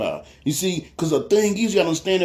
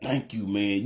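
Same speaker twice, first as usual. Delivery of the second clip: low, hushed retold gratitude; tearful thanks; 8 semitones lower, 8 dB quieter, slower than usual. Homeless man thanks his helper, low and tearful. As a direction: drop low and soft, as if moved to tears. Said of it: "tears coming out".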